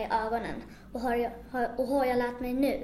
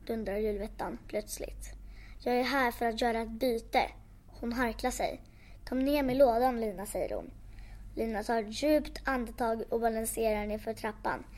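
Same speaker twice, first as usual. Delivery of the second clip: ordinary delivery, nothing marked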